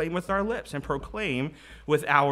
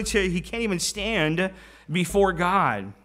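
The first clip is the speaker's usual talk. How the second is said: normal talking voice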